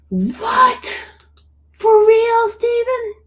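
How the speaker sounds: high, loud stunned questions; can't believe what she just read; shocked disbelief. Real, not acted. Asking a chatter if the news is true, stunned and high. Shocked reading live chat.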